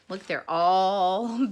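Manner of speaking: ordinary delivery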